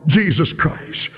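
ordinary delivery

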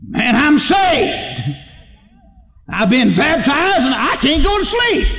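high, exultant cry of joy; jubilant jailer. Acted. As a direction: shout it like a man just saved. Jailer exults over salvation.

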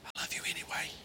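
a tender whispered I love you. Shown as a demonstration. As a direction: lean in and whisper it. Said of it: "Just lean in and say".

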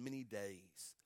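low, soft, falling close; hushed reading. Real, not acted. Reading of Jacob mourning for his son, hushed. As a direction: drop the phrase down low and soft. Same words softly, then cried out.

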